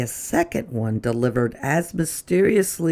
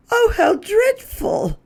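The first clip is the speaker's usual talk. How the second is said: much higher, dismayed lady's voice; dismayed Missus Prim; 3 dB louder than usual. Acted. Missus Prim crying out in dismay at the note. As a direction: gasp it out in dismay. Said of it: "cried Missus Prim".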